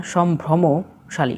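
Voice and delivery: "সম্ভ্রমশালী" drawn out as shom-bhromo, then shali; corrected slowly. Shown as a demonstration. Teacher corrects the word for learners, slowly broken up. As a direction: break "সম্ভ্রমশালী" slowly into its parts. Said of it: "সম্ভ্রান্ত হবে না".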